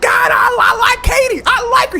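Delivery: high, loud hammed-up shouting; wild excitement. Tom jumping on Oprah's couch, wildly excited shout. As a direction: shout it while bouncing with excitement. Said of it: "He started jumping up and down".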